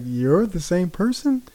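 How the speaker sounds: high, drawn-out incredulous question; surprised. Retold or imitated. Viewer reacting to an actor's real voice, surprised. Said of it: "What surprises me the most".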